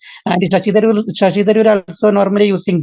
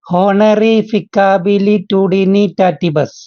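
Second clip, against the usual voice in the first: "Honorificabilitudinitatibus" spelled out syllable by syllable, slowly; slow it right down so everyone catches it; slow and careful; much slower than usual. Shown as a demonstration. Student says the long word again slowly for the class. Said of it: "ഒന്ന് slow ആയിട്ട്".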